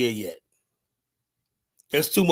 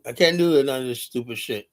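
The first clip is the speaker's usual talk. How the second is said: ordinary delivery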